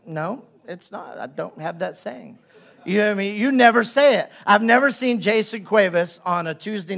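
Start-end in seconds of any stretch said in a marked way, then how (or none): 0.1-2.4 hushed, half-laughing no; quiet retort. Pretend. Congregation answering the pastor, hushed and amused. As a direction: mutter it back softly with a chuckle. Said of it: "you should sit there and go".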